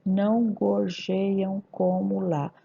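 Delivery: "Não gorjeiam como lá" slow, evenly syllabified; syllable division. Shown as a demonstration. Teacher showing the counts coincide, slow and syllabified. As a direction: spell out the verse by syllables. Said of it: "coincidiu o número de sílabas".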